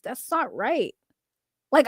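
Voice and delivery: ordinary talk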